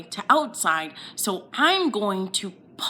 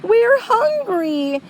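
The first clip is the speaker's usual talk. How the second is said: upset mom; 9 semitones higher, 3 dB louder, slower than usual. Acted. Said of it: "She said".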